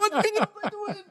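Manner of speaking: very high, incredulous squawk through laughter; mock outrage. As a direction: imitate a player losing it, laughing. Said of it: "that angered him so much".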